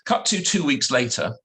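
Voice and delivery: ordinary delivery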